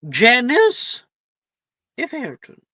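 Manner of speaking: calling out a name. Acted. McGonagall calls name at sorting.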